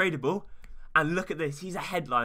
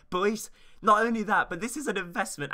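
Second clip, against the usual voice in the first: excited hype; 5 semitones higher, 4 dB louder than usual. Real, not acted. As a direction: sell it with a burst of energy. Hyping the investment to viewers.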